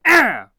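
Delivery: sharp loud pained shout; pained cry. Shown as a demonstration. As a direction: yelp as if struck. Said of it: "That's what I sound like".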